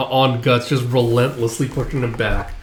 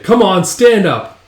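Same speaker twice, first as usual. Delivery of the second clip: high, loud, slow command; acted taunting order; 7 semitones higher, 7 dB louder, slower than usual. Follower drags beaten fighter up, taunting shout. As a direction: order the loser up loudly.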